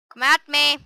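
loud taunting shout; shouting challenge. Real, not acted. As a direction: yell it at the enemy. Challenging a spider in game.

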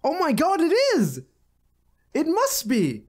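high, astonished realization; surprised. Real, not acted. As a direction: gasp it out like a revelation. Realizes it's Trump's wall.

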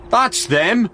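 high-pitched exclamation, a moderate shout. A narrator's reading. Frank spots his newspapers in the sergeant's hands, shouting. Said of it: "shouted Frank".

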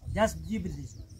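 ordinary talk

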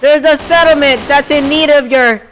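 hammed-up militiaman calling for aid. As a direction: stretch it out as a loud plea. Preston character calling out slowly.

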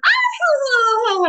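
high-pitched mock toddler squeal; long, high imitated cry; imitating child's squeal. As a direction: squeal like a kid who just tumbled. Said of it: "she stands up and she goes".

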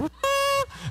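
high sustained horn imitation; mock ram's horn blast; imitated shofar blast. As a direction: hoot one long note like a shofar. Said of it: "He got his own thing out, went".

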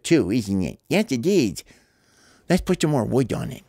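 usual speaking voice

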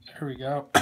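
quiet words with an accidental adolescent crack. Real, not acted. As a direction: voice cracking on a soft aside. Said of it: "Holy voice crack".

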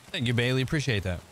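normal talking voice

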